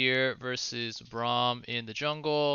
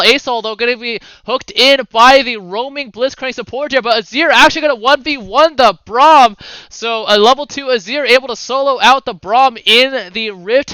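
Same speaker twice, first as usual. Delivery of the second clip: raised, punchy esports commentary; live play-by-play; 9 semitones higher, 14 dB louder than usual. Acted. Caster to viewers on the Azir and Braum duel, excited play-by-play. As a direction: call the duel with rising excitement.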